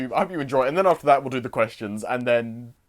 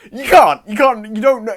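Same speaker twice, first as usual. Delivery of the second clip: emphatic; 7 semitones higher, 10 dB louder than usual. Real, not acted. Emphatic insisting to viewers.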